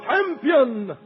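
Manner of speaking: normal talking voice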